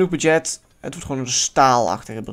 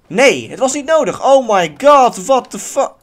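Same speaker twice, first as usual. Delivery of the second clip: loud, high burst of frustration; exasperated outburst; 8 semitones higher, 8 dB louder than usual. Real, not acted. Gamer raging at an unneeded fix, loud exasperation. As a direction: exclaim in exasperation, punching each word. Level fails, player exasperated.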